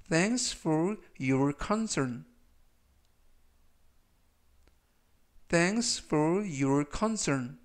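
each word drawn out for repetition; very slow read. Shown as a demonstration. Teacher reading example sentences for learners to repeat, very slow. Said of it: "제가 역시 천천히 세 번씩 읽어드리고요".

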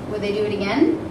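ordinary delivery, nothing marked

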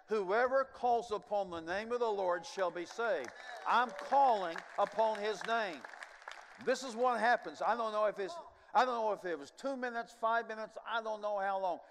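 full-voiced revival preaching; fervent preaching. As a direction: preach it out with rising force. Preaching climax of testimony.

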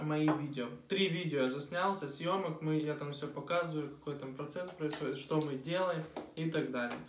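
normal talking voice

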